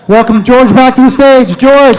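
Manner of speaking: loud stage call; announcer shout. Real, not acted. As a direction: call the name out big. Host calls comedian to stage.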